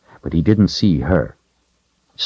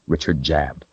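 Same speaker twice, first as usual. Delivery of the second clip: usual speaking voice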